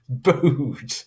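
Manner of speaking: "Booed." said through a laugh; laughing at the word. Shown as a demonstration. Teacher laughs at a misprint on the slide. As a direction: chuckle out "Booed.". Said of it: "'cause that's not a word".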